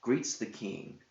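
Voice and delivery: ordinary delivery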